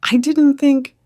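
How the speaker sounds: high, loud voice for Catherine; distressed. A narrator's reading. Catherine apologizing on the phone.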